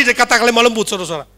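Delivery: ordinary talk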